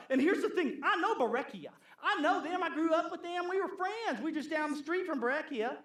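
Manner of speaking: lightly high, giggly gossip voice; gossipy church lady. Retold or imitated. Preacher playing a gossip who knows everyone, high and laughing. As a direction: gush fondly about the neighbours.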